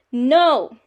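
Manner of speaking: loud, emphatic refusal; group yell. A narrator's reading. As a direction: yell it as a defiant family. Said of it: "all yelled".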